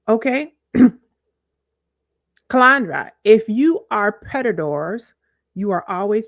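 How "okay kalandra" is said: usual speaking voice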